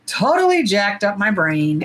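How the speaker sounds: usual speaking voice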